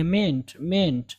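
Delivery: MEANT cut to a short "ment"; shortened vowel. Shown as a demonstration. Teacher showing learners to reduce it, shortened vowel. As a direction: say "मेंट" briefly with a short e. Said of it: "थोड़ा सा कम करेंगे".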